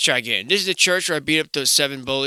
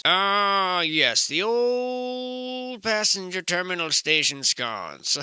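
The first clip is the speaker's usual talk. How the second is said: higher, slow drawn-out knowing voice; lightly voiced veteran of the area; mock old-timer; 6 semitones higher, much slower than usual. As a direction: savor it like a seasoned local. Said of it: "you simply add the old in front of it".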